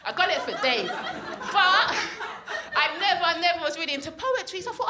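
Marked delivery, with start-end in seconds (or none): none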